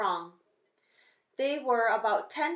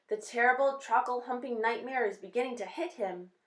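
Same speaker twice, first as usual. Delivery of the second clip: ordinary delivery, nothing marked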